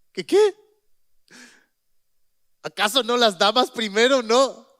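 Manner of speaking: high, loud disbelieving what; jolted by the nerve of it; indignant surprise. Real, not acted. Preacher reacting to the prophet's demand, shocked and incredulous.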